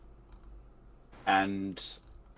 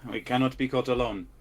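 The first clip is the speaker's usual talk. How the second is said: loud, raised battle call; 3 semitones higher, 5 dB louder than usual. Acted. Player's character calls out to scattered party mid-fight, loud rallying call. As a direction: call an urgent order to the party. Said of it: "and I'll call out".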